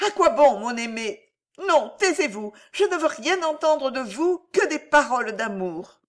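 high, urgent interruption; anxious pleading. A narrator's reading. Alice stops her lover telling secrets, anxious pleading. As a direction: cut him off in a high, pleading voice.

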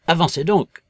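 sharp command. A narrator's reading. Invisible man driving Marvel onward, sharp command. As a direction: order him to get moving.

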